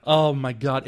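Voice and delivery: usual speaking voice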